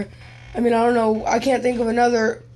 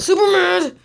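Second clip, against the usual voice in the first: sudden angry outburst; 7 semitones higher, 4 dB louder than usual. Real, not acted. Gamer denying he is super mad, sudden high outburst. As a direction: voice leaps up high on it. Calm cracks into a yell.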